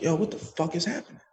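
retold astonishment, kept small and quiet; stunned hush. Man startled by God's voice in empty room, retold hushed bewilderment.

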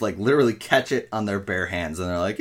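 ordinary talk

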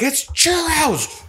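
high drawn-out yell; exasperated shout. Retold or imitated. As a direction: yell it in disbelief. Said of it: "and I'm like".

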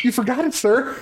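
high-pitched mock customer-service call; hammed-up helpful fast-food employee; eager service worker. Mimics eager worker chasing customer's car.

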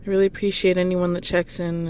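ordinary talk

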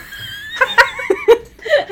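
a burst of high laughter; loud high laugh. Real, not acted. Laughs at her own forgetfulness.

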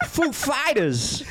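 high, drawn-out band announcement; Christopher Walken. Acted. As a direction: break the name up in comic impersonation. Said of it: "he's like".

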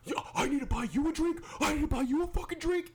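high mocking sarcastic threat; mock aggressive jock. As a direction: sneer it back as the angry jacked guy. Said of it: "And he's like".

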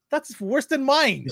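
high, drawn-out mock protest; mock indignant. Husband retelling his reply to wife's joke, mock indignant.

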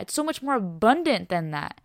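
ordinary talk, nothing marked

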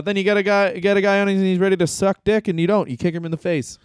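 usual speaking voice

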